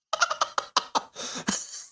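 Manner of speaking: a high airless giggle at the blown lead; high breathy laugh. Real, not acted. Host laughing at the Rangers' collapse, high airless giggle. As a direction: let a squeaky silent laugh escape.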